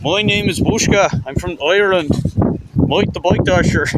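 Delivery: badly faked Irish accent as a made-up character. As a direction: put on a hammy Irish accent. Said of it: "I was doing that bad accent".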